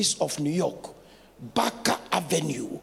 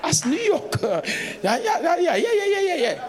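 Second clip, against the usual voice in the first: high, loud laughing yeahs; 10 semitones higher, 4 dB louder than usual. Real, not acted. The preacher laughs with the congregation about New York. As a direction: laugh it off, amused.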